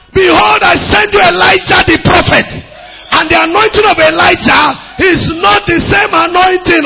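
high, loud preached proclamation; shouted scripture. Acted. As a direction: proclaim the verse at full force. Preaching scripture at full force.

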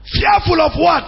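high, challenging pulpit questions; forceful, urgent preacher's cry; preaching at full force. Acted. Preaches with raised voice.

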